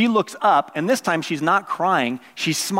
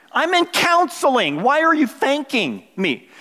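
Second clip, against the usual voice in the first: higher, slow incredulous protest; pitched-up disbelieving retort; exasperated disbelief; 7 semitones higher than usual. Retold or imitated. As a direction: spell it out like she's crazy. Exasperated retort to thankful lady.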